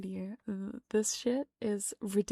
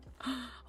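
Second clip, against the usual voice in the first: soft pretend gasp of dismay; little staged intake of breath; mock gasp; 3 semitones higher, 6 dB quieter than usual. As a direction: gasp in feigned surprise. Staged shock continues.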